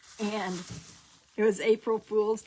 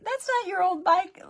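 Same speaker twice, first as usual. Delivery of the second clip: insisting; 11 semitones higher, 5 dB louder than usual. Retold or imitated. Grandma insists to crying child.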